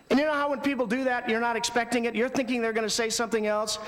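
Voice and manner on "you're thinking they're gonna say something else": ordinary talk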